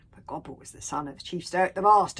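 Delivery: plain reading voice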